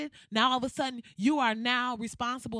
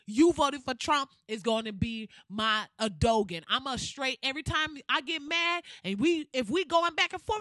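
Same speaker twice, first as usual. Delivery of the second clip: usual speaking voice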